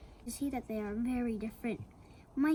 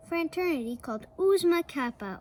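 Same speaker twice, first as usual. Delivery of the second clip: plain reading voice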